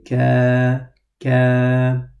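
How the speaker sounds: Ka thin, descending; light Ka. Shown as a demonstration. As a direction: correct light Ka. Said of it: "sound with the Kaf descends downwards".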